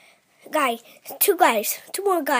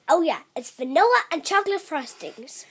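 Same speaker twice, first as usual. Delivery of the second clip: usual speaking voice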